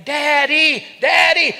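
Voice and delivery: put-on high, loud little-boy yell of Daddy; child yelling Daddy. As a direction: shout it like an excited small boy grabbing a leg. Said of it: "starts yelling".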